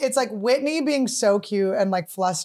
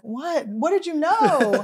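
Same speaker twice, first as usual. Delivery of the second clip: baffled what; 5 semitones higher, much slower than usual. Retold or imitated. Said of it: "And we're like".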